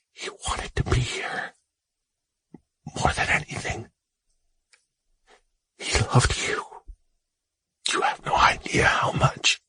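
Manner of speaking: whispered, voice quavering; trembling whisper. Acted. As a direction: hold back tears in a whisper. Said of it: "His voice quavered".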